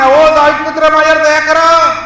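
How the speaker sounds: high, loud plea for mercy; full-voiced, high cry; crying out loudly. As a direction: shout it out as a desperate appeal. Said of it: "मोठ्याने ओरडून".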